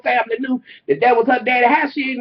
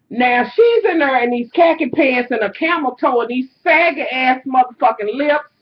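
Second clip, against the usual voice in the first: ordinary delivery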